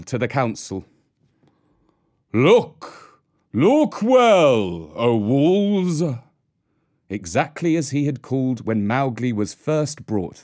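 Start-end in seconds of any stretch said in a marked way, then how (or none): 2.3-6.0 louder ringing call to the pack. Acted. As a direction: call the wolves to council. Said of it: "called the old call".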